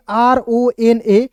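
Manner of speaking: very slow, each letter set apart; teacher spelling a word slowly for beginners. Shown as a demonstration. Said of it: "क्या पढ़ेंगे?".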